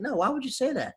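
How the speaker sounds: incredulous. Retold or imitated. Retelling a bewildered reply.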